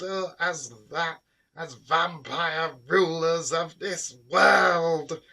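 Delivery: lightly voiced vampire queen declaring her plan; hissing vampire. A narrator's reading. Vampire queen explains her plan to rule, hissing. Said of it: "she hissed".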